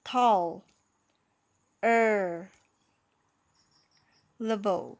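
"tall... er... level" as separate parts with long pauses; syllable by syllable. Shown as a demonstration. Teacher reads the word slowly for learners, syllable by syllable. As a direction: break it up, first part "tall" loud. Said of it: "อันนี้อ่านว่าไงนะคะ".

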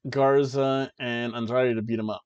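ordinary talk, nothing marked

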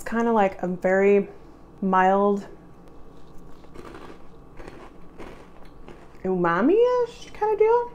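snack in mouth; chewing while talking. Real, not acted. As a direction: mouth partly full. Seen 3: Bites snack at -4, chews while talking, takes another piece at 15.

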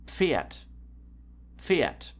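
"Pferd" with a barely heard p before f; careful "Pferd", p melting into f; pf pronunciation demo. Shown as a demonstration. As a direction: show "Pferd" starting on one pf sound. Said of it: "You hardly can hear the P here".

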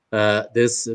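ordinary talk

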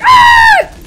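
very high, very loud shriek; piercing full-out scream; death scream. Acted. Figure killed in battle, piercing death scream.

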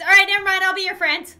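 loud, high, sudden agreement; eager turnaround. Pretend. Player bribed with gummies, loud eager turnaround. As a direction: blurt it out loudly, completely won over.

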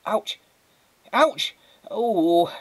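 high, sharp stung yelps; yelping in pain. Acted. Alcohol on mother's bites and scratches, yelping. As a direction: yelp as the sting hits.

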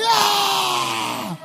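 long, high, loud yell; re-enacted scream of excitement; excited scream. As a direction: let out a whoop of excitement. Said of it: "I saw I got excited".

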